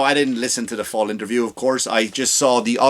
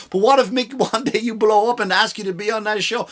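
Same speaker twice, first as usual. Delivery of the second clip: much higher scolding voice; high-pitched imagined critic; mock objector; 9 semitones higher than usual. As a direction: scold him in a raised pitch.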